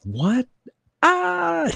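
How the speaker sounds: very high-pitched squealed disbelief; mock angry outburst. Acting out the first reaction to seeing the game, mock outrage. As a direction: break into a hammed-up outraged shriek. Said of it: "the first reaction is anger".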